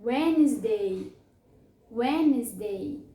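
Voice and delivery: "Wednesday" slowly as WENZ-day, no first D; silent D. Shown as a demonstration. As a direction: draw out "Wednesday" in two syllables, WENZ-day. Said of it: "ele é mudo".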